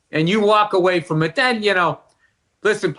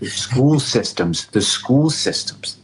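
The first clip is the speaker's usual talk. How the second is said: lower, slow, drawn-out pout; coaxing wife voicing school systems, lower and slow; mock pouting wife; 6 semitones lower, slower than usual. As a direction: sell it like a wife angling to move. Wife voiced pushing schools.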